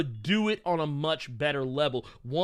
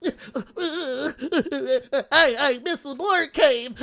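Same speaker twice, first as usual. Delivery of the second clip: high whiny fake sobbing; mock crying hater; 10 semitones higher, 4 dB louder than usual. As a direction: blubber like a sore hater. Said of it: "That's what they sound like".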